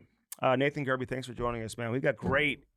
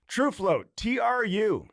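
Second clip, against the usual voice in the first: loud, higher brand callout; hype shout; 10 semitones higher, 6 dB louder, much slower than usual. Acted. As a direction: belt the sponsor name out. Host yells sponsor name.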